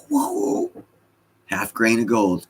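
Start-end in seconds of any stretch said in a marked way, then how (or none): 0.1-0.7 very high squeaky whoa; exaggerated amazed whoa; mock whoa. Real, not acted. Streamer reacting to a viewer's joke, squeaky high whoa.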